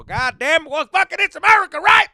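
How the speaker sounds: hammed-up America cheer; mock patriotic whoop. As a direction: shout it like a proud cowboy. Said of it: "me sentía más como".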